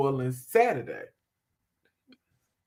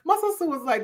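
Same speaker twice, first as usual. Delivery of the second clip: normal talking voice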